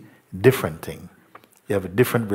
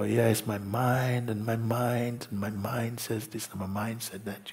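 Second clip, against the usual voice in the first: mocking mind talk; 9 dB quieter than usual. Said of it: "many people talk".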